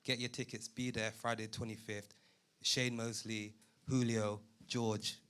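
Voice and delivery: usual speaking voice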